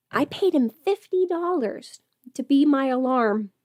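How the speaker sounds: ordinary talk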